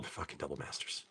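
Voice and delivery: barely voiced, very low mutter; hushed aside. Real, not acted. Seller marvelling at double masters, under the breath. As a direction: drop almost to a whisper.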